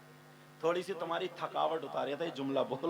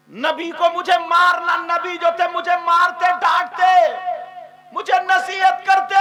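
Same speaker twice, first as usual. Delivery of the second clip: shouted lament; 12 semitones higher, 18 dB louder than usual. Acted. Hafsa's remorse, preached.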